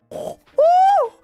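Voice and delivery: loud, very high effeminate "Oh!"; a startled little shriek jolting awake; startled high yelp. Retold or imitated. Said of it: "a really loud, effeminate".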